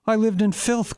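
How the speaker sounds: high, pained outcry; crying out. A narrator's reading. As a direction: burst out high and hurt. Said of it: "cried Franz".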